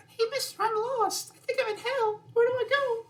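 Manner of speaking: put-on very high, small lost kid; lost child voice. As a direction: squeak it out like a scared little boy. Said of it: "It sounds just like that".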